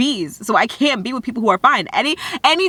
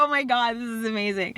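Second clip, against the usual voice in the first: mock emotional; 3 semitones higher, 9 dB quieter than usual. Overcome with pride.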